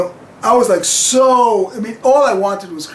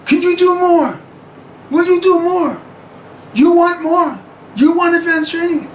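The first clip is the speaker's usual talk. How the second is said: much higher, imitating the teacher yelling; retold yelling; 9 semitones higher than usual. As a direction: mimic the instructor pushing you to the limit, pitched high. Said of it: "every time she's yelling".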